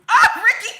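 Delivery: high, loud shriek breaking into laughter; real laughing outburst. Real, not acted. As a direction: burst out laughing at a naughty friend. Cracks up at chat comment.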